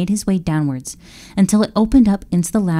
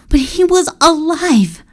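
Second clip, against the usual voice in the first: elated; 10 semitones higher, 4 dB louder than usual. A narrator's reading. Elated realization.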